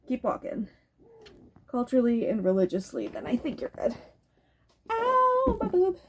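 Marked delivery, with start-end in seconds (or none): 4.9-6.0 high, drawn-out real "ow"; sudden pain. Real, not acted. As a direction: yelp in pain, pitch shooting up. Sudden pain while filming.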